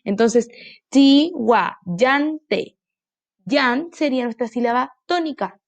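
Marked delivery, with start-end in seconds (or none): 0.9-2.7 "ti, wa, yan, te" as separate beats; four distinct syllables, ti, wa, yan, te. Shown as a demonstration. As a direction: chop out "ti, wa, yan, te". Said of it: "esa no suena fuerte".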